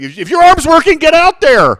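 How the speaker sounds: very high, loud hammed-up Wisconsin voice; mock Wisconsin accent. Podcaster imitating Green Bay staff, loud Wisconsin accent. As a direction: shout like a Wisconsin coach. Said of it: "went back and forth with Wisconsin accents".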